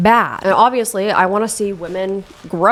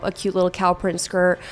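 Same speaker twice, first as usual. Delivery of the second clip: ordinary delivery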